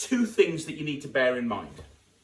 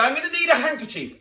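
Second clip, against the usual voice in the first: higher, loud stage call; showman line; 12 semitones higher, 7 dB louder than usual. Shown as a demonstration. Magician demoing the patter, loud and higher. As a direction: announce it to the crowd. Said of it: "you say".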